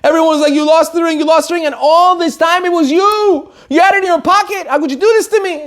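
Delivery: high, outraged complaint; beside herself. Retold or imitated. Wife berating her husband over the lost ring, outraged. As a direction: let the hurt and anger pour out. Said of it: "The wife was besides herself".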